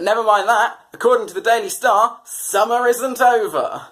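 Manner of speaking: high, loud and eager, then slowing on the last words; mock excited friend. Friend brushing off a shooting, excited and loud. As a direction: gush it like a gossip who has missed the point. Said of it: "And they went".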